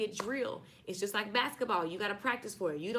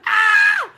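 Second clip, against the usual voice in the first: loud high shriek of laughter; 19 dB louder than usual. Real, not acted. Cracking up at her friend's church joke, shrieking laugh. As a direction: let out a screaming laugh.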